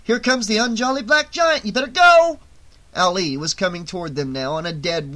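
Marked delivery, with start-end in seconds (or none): 0.0-2.4 high, building to a shout; alarmed, high-pitched yell; urgent warning. A narrator's reading. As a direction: warn urgently, exploding on the last words. Urgent warning as attacker approaches.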